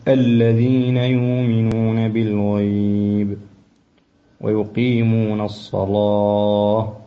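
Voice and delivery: الذين يؤمنون بالغيب slow, with the lam of الصلاة heavy; careful recitation. Shown as a demonstration. Teacher modelling the correct reading for the student. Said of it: "فنقول".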